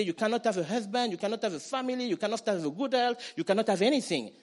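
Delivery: mock taunting. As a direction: taunt them like a jeering enemy. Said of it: "The enemy is taunting you".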